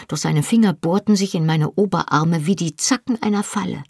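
plain reading voice

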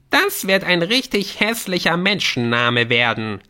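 high-pitched, gleeful jeering; hooting gnome. A narrator's reading. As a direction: crow with malicious glee as a gnome. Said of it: "johlte der Gnom". (johlender Gnom, hoch und schadenfroh)

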